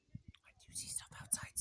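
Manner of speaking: hushed whisper to the pet. Real, not acted. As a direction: breathe the words, no voice. Whispering to the dog.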